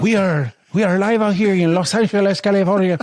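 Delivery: put-on high, accented live-TV presenter; Telemundo host. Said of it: "a host for Telemundo".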